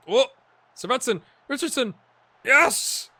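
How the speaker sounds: loud, high cry of joy; goal celebration. Real, not acted. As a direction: shout it as the ball goes in. His team scores.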